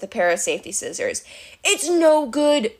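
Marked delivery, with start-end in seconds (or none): 1.6-2.8 slow, higher-pitched complaint; drawn-out, mildly acted exasperation; frustrated captive. A narrator's reading. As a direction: stretch it out as a fed-up prisoner. Captive despairing at Grover's efforts.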